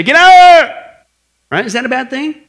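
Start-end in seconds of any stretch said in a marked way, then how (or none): 0.0-0.8 high, loud, urgent yell; sudden full-voiced warning shout. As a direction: yell someone out of danger. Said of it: "yells at you".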